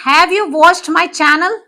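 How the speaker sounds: slow, rising question read of "have you watched my channel?"; रुक-रुक कर सवाल की तरह "have you watched my channel?"; question tune demo. Shown as a demonstration. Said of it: "ये देखिए question कैसे शुरू हो रहा है".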